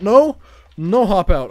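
sudden loud no; shout. Real, not acted. Frustrated gamer yelling at the game, loud. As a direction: yell it at the screen.

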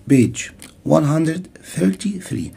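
normal talking voice

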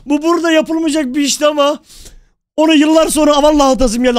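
shouting. Acted. Roleplayer bidding farewell after years, shouting. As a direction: holler it out to everyone around. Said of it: "fazla bağırma ya".

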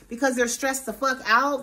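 normal talking voice

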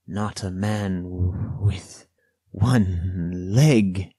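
slow, halting gasp of dread. A narrator's reading. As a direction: break it up as if short of breath. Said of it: "he gasped".

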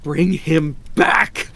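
high, slow, loud demand; crying out. A narrator's reading. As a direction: cry it out word by word. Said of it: "cried the old woman".

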